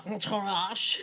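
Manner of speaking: exaggerated French pronunciation of Entourage; really French accent. Imitating friend's French Canadian boyfriend, really French accent. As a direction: suddenly go heavily French on the one word. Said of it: "say really French".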